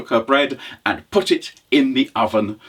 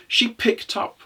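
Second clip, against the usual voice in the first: usual speaking voice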